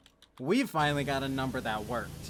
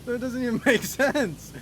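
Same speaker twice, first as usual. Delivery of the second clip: incredulous, higher-pitched laughing delivery; laughing while talking; 5 semitones higher, 3 dB louder than usual. Real, not acted. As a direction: voice climbing high through laughter. Cracks up at own story.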